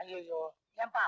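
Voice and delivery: pitying groan. Acted. Lender pitying crippled wife.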